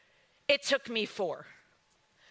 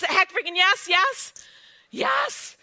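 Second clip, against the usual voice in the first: louder and higher, last yes jumping way up; pumped-up yes, yes, yes; fired-up yes; 7 semitones higher, 6 dB louder, slower than usual. Real, not acted. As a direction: shout your yes with rising joy. Enthusiastic yes to the dream.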